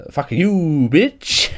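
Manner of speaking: high, drawn-out gloating jeer; gleeful mock taunt. Retelling his gloating over a banned player, gleeful taunt. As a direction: crow it in a sing-song pitch, rising on BITCH. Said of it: "pissing myself laughing, just going".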